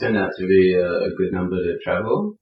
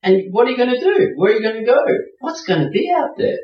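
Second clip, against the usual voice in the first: inner voice panicking; 9 semitones higher than usual. Retold or imitated. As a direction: voice your nervous subconscious pleading not to leave. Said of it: "My subconscious goes".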